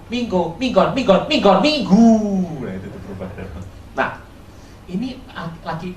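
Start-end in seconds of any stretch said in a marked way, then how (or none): 0.1-2.1 Minggo, Minggon, Minggon, Minggon, Minggu sounded out, building louder; mock Javanese folk turning Domingo into Minggu, getting louder; Javanese mishearing. Preacher imitating Javanese mangling Domingo, building louder. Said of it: "orang Jawa nggak dengar Domingo".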